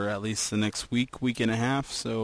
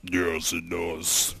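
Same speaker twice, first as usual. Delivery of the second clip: lower, slow, deep put-on voice; lower, slower deep voice; deeper head voice; 4 semitones lower, much slower than usual. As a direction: drop the voice low and drag it. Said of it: "my head voice just has to be slightly deeper".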